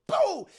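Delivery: high, loud, sudden "boo"; voiced slingshot release; imitated launch noise. Streamer imitating Tank launching Bradley like a rubber band, loud high noise.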